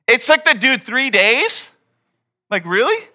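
high, emphatic disbelieving question; incredulous. Real, not acted. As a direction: ask it in loud disbelief. Preacher incredulous at Jonah's delay.

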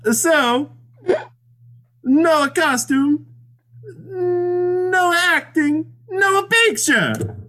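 put-on very high, drawn-out Italian accent; cartoon Italian lament, stretching the words; mock Italian accent. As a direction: wail it slowly in a hammed-up Italian accent. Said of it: "do so in an Italian accent".

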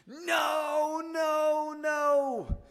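very high, loud mock wail of protest; imitated yelling child. Son protesting dad's terrible Scottish voice, imitated high yell. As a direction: mimic a kid yelling in a high squeal. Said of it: "my son starts yelling at me".

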